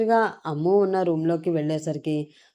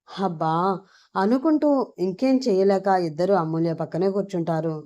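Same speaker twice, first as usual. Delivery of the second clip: ordinary delivery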